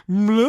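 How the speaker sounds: high, loud comic bleat of alarm; cartoonish "mleh" scream; mock scream. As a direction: imitate a goofy startled yelp. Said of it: "the guy screams and goes".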